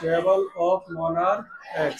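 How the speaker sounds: slow, word by word dictation. Shown as a demonstration. Teacher dictating slowly to the class. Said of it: "साथ में बोला करो बेटा".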